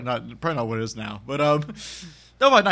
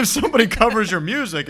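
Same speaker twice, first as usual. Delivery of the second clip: mock outburst; 10 semitones higher, 8 dB louder than usual. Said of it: "He's like".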